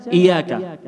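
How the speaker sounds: "iyyaka" with a quick single y, as "iyaka"; missing doubled consonant. Shown as a demonstration. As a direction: skim over the y in iyyaka. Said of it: "mungkin di antara kita yang membaca". (iyyaka tanpa tasydid)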